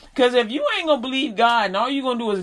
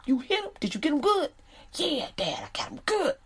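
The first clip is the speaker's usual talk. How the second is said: hushed high voices; 6 semitones higher, 5 dB quieter than usual. Retold or imitated. Dad and son conspiring.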